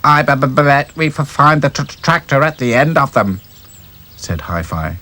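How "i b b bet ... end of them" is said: higher stuttering doll voice; stammering Hi-Fi. Acted. Stammering doll guessing where tracks lead. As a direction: trip over the first sounds.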